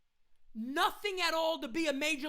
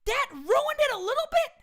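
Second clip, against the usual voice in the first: higher, disbelieving echo of the quote; incredulous repeat; 8 semitones higher, 3 dB louder than usual. Real, not acted. Fan repeating the manager's quote in disbelief, incredulous. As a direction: throw the quote back in outrage. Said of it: "Are you mad?".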